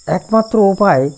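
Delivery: usual speaking voice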